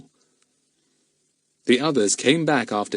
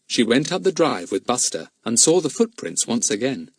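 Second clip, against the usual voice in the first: ordinary delivery, nothing marked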